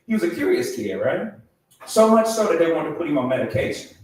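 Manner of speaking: usual speaking voice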